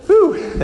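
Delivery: high puffing whew after stairs; breathless whew. Real, not acted. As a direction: blow out a winded whew. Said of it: "that feels good".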